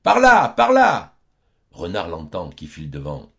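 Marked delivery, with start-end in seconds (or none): 0.0-1.2 a high, drawn-out yell; hollering as the chase begins; slow, loud villagers' cry; crowd shouting. Acted. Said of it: "Tous s'écrient".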